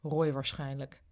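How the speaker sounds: ordinary delivery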